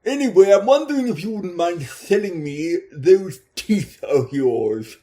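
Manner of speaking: a hint of a greedy pimp making an offer; sleazy pimp. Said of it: "a Ponzi pimp".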